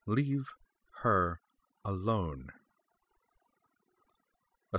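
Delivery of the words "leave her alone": a controlled threat with pauses between the words; measured warning. A narrator's reading. Stranger intruding to protect a woman, slow measured warning.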